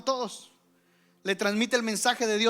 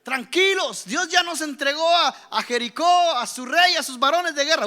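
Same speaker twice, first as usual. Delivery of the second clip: higher, louder, rousing leader's call; Joshua rallying the people; 6 semitones higher, 7 dB louder than usual. Retold or imitated. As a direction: call out the good news to the people. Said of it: "llegó Josué y le dijo". (Josué anunciando la victoria, voz alta y entusiasta)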